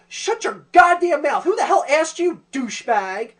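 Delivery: high, very loud mock-furious shout; angry telling-off. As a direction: yell down a tattletale, hammed-up. Telling off the tattletale character.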